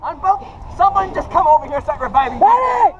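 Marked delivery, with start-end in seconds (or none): 2.4-2.9 high loud call; man down shout. Real, not acted. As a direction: yell for the medic. Calling for a medic.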